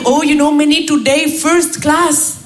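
blissful Indian colleague, drawn-out ending; Raj. Retold or imitated. Indian colleague arrives at work every day, slow and blissful. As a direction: stretch the last words in exaggerated contentment. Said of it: "il arrive".

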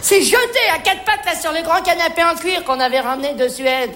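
ordinary talk, nothing marked

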